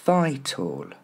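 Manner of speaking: VITAL as "VY-tol"; first-syllable stress. Shown as a demonstration. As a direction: stress VY in "vital", fall away after. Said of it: "Marquez bien l'accent tonique sur la première".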